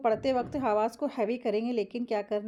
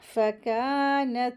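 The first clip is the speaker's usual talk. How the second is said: फ़कानत with a long held kaa; stretched madd; much slower than usual. Shown as a demonstration. Teacher models two-beat madd, फ़कानत with drawn-out kaa. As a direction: draw out the aa of फ़कानत. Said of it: "दो हरकत खींच के पढ़ेंगे".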